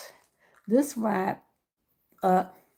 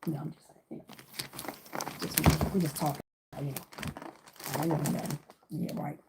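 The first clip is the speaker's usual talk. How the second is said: muttered aside; 7 semitones lower, 10 dB quieter than usual. Real, not acted. Muttering to herself.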